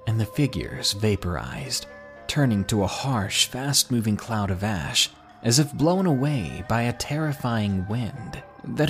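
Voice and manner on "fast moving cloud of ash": plain reading voice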